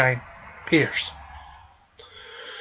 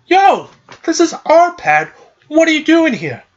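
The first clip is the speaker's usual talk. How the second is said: mock tough kid; 9 semitones higher than usual. Hayner challenges Sora in their hideout, mock tough higher voice. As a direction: puff up like a kid guarding his turf.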